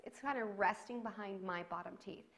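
ordinary talk, nothing marked

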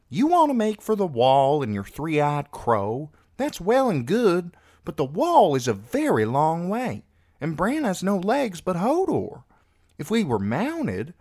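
put-on high, forceful girl's voice arguing; spirited young girl, high and insistent; exasperated sister. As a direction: press the objection, pitched well up. Voices Meera arguing with Jojen.

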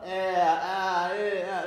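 bare vowels sung out one by one; "eh" and "ah" said alone, loud at first; vowel sounds. Shown as a demonstration. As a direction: voice "Eh, ah" as open vowels on their own. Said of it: "alliterating on the vowel sound".

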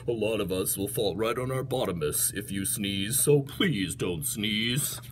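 low, soft, heavy hippo voice; ponderous hippo. Acted. Hippo warns of falling on bottoms, low ponderous voice. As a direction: rumble low, slowing on the plea. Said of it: "The hippopotamus said".

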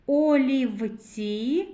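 олівці very slow, в picked out; drawn-out sounding-out. Shown as a demonstration. Teacher leading children to the sound в, slowly drawn out. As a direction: stretch олівці so the в stands apart. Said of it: "звуковий аналіз слова олівці".

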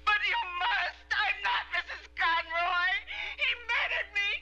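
high, crying shouts; tearful, high-pitched desperation; hysterical pleading. Acted. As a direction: beg frantically to be believed. Hysterical woman begs to be believed.

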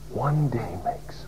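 slow, whispered reflection; awed whisper. Retold or imitated. Preacher recalling his inner thought, slow whisper. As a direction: breathe it out slowly in wonder.

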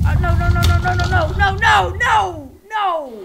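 loud repeated no, fading; despairing cries. Real, not acted. Player losing a friend, despairing no. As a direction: cry out in despair.

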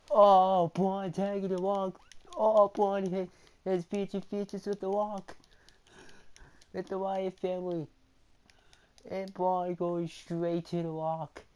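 high, loud match commentary; excited play-by-play. Real, not acted. Gamer yelling commentary as Braun attacks The Rock. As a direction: call the action like a hyped announcer.

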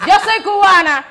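high, loud cry into the megaphone; shouting from the megaphone at the rally; loud announcement. Retold or imitated. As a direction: proclaim it to the crowd.

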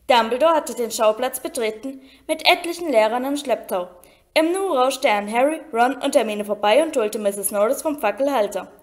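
ordinary delivery, nothing marked

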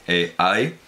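normal talking voice

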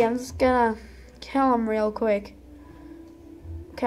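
usual speaking voice

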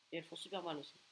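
ordinary talk, nothing marked

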